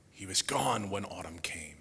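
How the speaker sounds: whisper. Acted. Quoting a sad song lyric.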